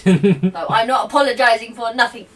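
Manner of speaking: laughing. Acted. Defiant in-character retort, laughing.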